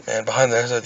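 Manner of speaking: ordinary talk, nothing marked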